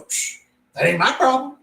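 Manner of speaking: high-pitched mocking rebuff; dismissive homeowner. Homeowner refuses blame for dead power, high dismissive imitation. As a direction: imitate a huffy customer brushing it off, pitched well up.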